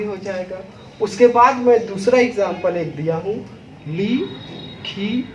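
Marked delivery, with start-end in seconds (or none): 3.9-5.2 ली खी said softly, one by one; slow, separate ली खी; syllable demo. Shown as a demonstration. Teacher spelling ली खी aloud for pupils. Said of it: "फिर पुनः बोलिए".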